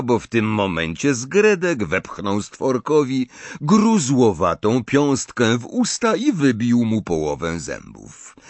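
ordinary delivery, nothing marked

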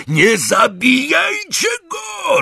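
very high, drawn-out scream; desperate roar. A narrator's reading. Malfoy to Crape and Goyle, desperate roar. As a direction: scream it out slowly, at the top of the range. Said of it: "ryknął Malfoy na Crape'a i Goyla".

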